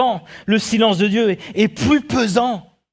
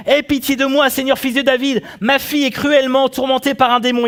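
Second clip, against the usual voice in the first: higher-pitched plea for mercy; crying out; 5 semitones higher than usual. Acted. Canaanite woman begging Jesus for her daughter, crying out. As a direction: call out imploringly, voice held high. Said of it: "qui lui criait".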